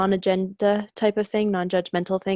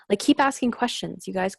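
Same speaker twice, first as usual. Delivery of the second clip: usual speaking voice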